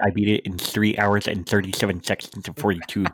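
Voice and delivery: fussy know-it-all, overly precise; nerd voice. Pretend. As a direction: correct everyone like a pedantic nerd. Mocking a nerd correction.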